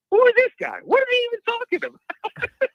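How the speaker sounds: high, loud exasperated outburst; cussing at the sky. Retold or imitated. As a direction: rant in a high-pitched, disbelieving voice. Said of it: "got me cussing at the sky".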